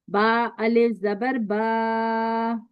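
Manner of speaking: very slow, with the last बा held for two seconds; long a with alif. Shown as a demonstration. Teacher models बा अलिफ जबर बा with a held बा. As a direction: hold बा long and even. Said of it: "इसको भी दो सेकंड आपने खींचना है".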